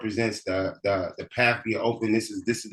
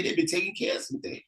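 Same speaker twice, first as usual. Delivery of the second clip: ordinary talk, nothing marked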